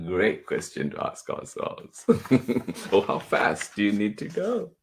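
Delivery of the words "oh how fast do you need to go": ordinary talk, nothing marked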